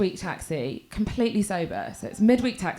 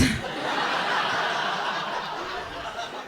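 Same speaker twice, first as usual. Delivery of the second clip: silent wheezing laugh; 6 dB quieter than usual. Real, not acted. Laughter after punchline.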